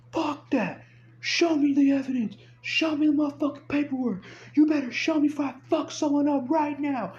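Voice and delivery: high-pitched angry demands; retold yelling. Truck owner demanding paperwork from the driver, yelling. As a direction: imitate a furious man yelling, voice way up high. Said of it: "he started yelling".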